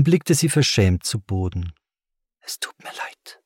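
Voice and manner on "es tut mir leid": soft, unvoiced whisper; hushed, whispered apology; breathed. A narrator's reading. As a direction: breathe it out barely audibly. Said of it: "hauchte sie".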